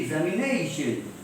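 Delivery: "examination" with NA lifted and held; stressed syllable before -tion. Shown as a demonstration. Teacher giving an example sentence, stress on NA in "examination". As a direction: swell on the NA in "examination". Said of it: "be pronounced loudly".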